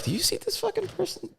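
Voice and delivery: very high, hushed exaggerated gasp voice; mock scandalized person. Friend mocking people online, high scandalized voice. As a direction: squeak it up like someone appalled. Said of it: "people are like".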